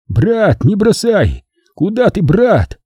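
high, hammed-up desperate plea; theatrical wail for help; anguished calling. A narrator's reading. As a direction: call after your brother as if abandoned. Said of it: "принялся с надрывом звать".

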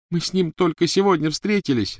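high pleading sob; sobbing. A narrator's reading. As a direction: plead through tears. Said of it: "рыдала Гермиона".

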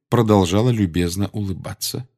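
plain reading voice

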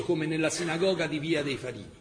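ordinary talk, nothing marked

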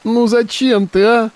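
put-on high, pleading girl's voice; tearful plea. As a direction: beg him through tears. Girl weeps over dying uncle.

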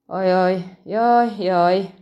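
hammed-up groaning "ajaj"; theatrical fretting lament. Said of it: "sopánkodott a leendő kapitány".

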